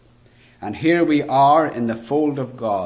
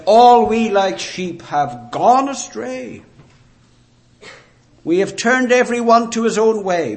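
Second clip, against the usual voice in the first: preaching; 8 semitones higher, 5 dB louder than usual. Acted. Preacher proclaims Isaiah.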